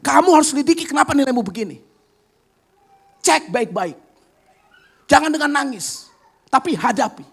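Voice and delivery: high, firm big-sister voice, a touch fast at first; older sister's firm advice. Retold or imitated. Older sister scolds crying sister, firm and high. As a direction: urge her little sister to face it, not cry. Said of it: "kakaknya bilang apa".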